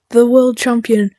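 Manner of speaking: ordinary talk, nothing marked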